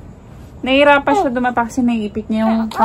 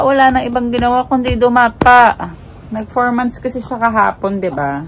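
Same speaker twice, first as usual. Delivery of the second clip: ordinary talk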